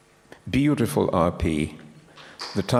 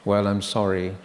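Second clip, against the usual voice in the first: normal talking voice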